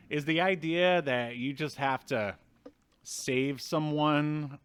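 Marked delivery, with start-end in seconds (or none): none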